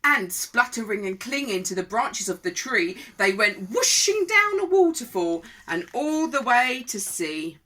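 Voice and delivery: lively, projected read-aloud; rhythmic storybook telling; children's story narration. A narrator's reading. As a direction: read it to small children with relish. Reads a picture book.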